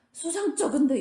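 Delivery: high, loud suspicious jab; a sharp, mock-suspicious "hmm, fishy"; suspicious spouse. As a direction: sound instantly suspicious of your spouse. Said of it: "이렇게 볼까요?".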